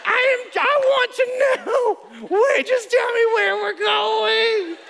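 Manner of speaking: very high, loud, slow hammed-up wail; mock-sobbing plea to get to the point; husband lost in his wife's story, wailing high and loud; desperate husband. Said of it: "and I'm like".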